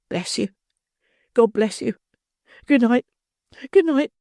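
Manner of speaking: put-on a desperate girl torn between fear and hope; troubled young girl. A narrator's reading. Girl's farewell.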